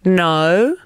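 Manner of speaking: British "No" with a rounded, rising vowel; British accent. Shown as a demonstration. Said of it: "Here's British".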